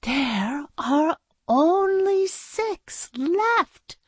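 high, slow, drawn-out dismay. Acted. Teacher looking in her paperclip box, dismayed. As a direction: stretch each word out in horror. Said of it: "shaking her head in dismay".

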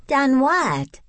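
high-pitched curious question. A narrator's reading. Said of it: "Rebecca asked curiously".

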